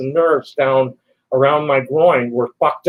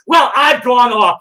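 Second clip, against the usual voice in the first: angry outburst; 6 semitones higher, 5 dB louder than usual. Real, not acted. Rant climax.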